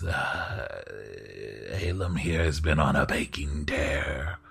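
slow, low lord, fading to a whisper; a proud host praising his helper; courtly host voice. Acted. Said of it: "host answers Alem".

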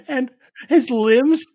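high, loud, laughing hard through the words; real laughing. Real, not acted. Game master cracking up over limbs falling off, laughing. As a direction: barely get the joke out through laughter.

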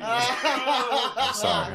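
high, drawn-out apology through laughter; helpless laughter. Real, not acted. Apologizing after their own joke, helpless laughter. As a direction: squeeze it out slowly while cracking up.

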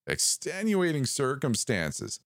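slow, high, drawn-out repeat; a sinister voice relishing the phrase; savoring the words. A narrator's reading. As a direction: roll each word around like tasting it. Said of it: "as though tasting them".

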